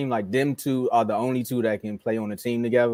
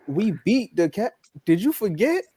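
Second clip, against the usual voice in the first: higher, disbelieving mock teammates; exasperated Boston teammates; 5 semitones higher than usual. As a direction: tell him off and put him in his place. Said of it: "they was like".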